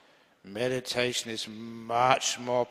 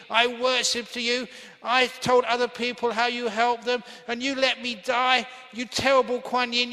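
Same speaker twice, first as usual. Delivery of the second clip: high, loud furious scolding; 10 semitones higher, 6 dB louder than usual. Acted. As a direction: rage at the goddess who let you die. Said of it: "did he scold her".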